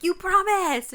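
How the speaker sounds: high, loud wail of betrayal; betrayed wail. Retold or imitated. Little girl wailing at her sister for breaking her promise, high and loud. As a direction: cry out that she broke her word. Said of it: "I was like".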